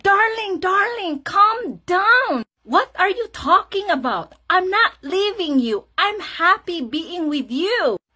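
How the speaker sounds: high, emphatic calming plea; exasperated reassurance. Retold or imitated. Wife telling sobbing husband to calm down, emphatic.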